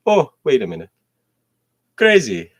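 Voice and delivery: loud, high exclamation; mock eureka; sudden realization.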